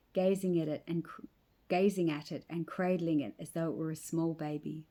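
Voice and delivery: ordinary delivery, nothing marked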